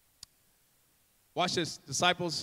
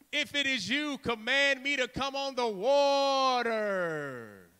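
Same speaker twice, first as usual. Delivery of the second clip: raised cry, last word stretched long; drawn-out dramatic plea; Peter calling out; 3 semitones higher, slower than usual. Acted. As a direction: call across the water, slow and trailing. Acts Peter calling to Jesus.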